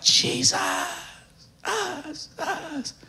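breathy cry with soft, high echoes; preacher acting out the lepers' cry as imagined, echoing. Shown as a demonstration. Trail the last syllable off like an echo. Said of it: "That's how I read it in my mind".

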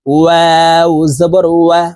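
slow ওয়াও with both lips rounded and open; long, careful ওয়াও through rounded lips. Shown as a demonstration. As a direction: model ওয়া with a full w glide. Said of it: "দুই ঠোঁট গোল করে".

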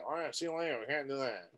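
a hint of a quitter waving it off; dismissive mutter. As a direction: mumble a quitter's goodbye. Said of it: "How many times have I heard that".

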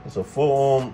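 ordinary talk, nothing marked

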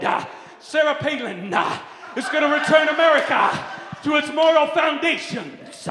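high, full-force revival shout punctuated with "ah"; mock a televangelist at full cry, gasped "ah" after phrases; fervent evangelist preaching. Shown as a demonstration. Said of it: "you have to do something like this".

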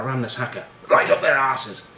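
mock-impassioned war cry, Scottish accent; rally the troops in a put-on Scottish accent; rousing Scottish captain. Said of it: "as [name] gives this speech".

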